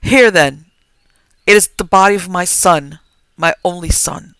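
loud grieving confession; grieving outburst. Acted. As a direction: confess in raw grief.

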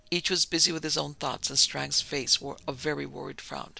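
ordinary delivery

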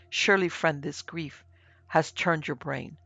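ordinary delivery

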